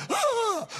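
sharp high shriek of shock; high cry. Retold or imitated. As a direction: let out a sudden high cry as she falls. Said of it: "she's like".

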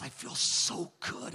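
slow, breathy hushed voice; almost whisper it through tears; soft tearful relief. Retold or imitated. Man after prayer, crying with peace, speaks hushed and slow. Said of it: "he's crying with peace".

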